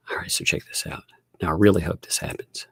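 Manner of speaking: hushed, unvoiced whisper before the reveal. Real, not acted. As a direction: whisper it like a secret.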